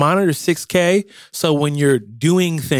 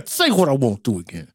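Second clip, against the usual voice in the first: clenched-teeth warning; through gritted teeth. Retold or imitated. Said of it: "talking through their teeth like".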